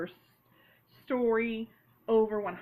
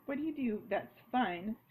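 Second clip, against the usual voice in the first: normal talking voice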